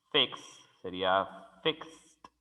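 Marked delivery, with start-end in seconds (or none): 1.6-2.3 fixed said slowly, ending KS-T; fixed as "fikst", one syllable; T sound ending. Shown as a demonstration. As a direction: let a T click after the X of fixed. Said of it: "Sonido de T".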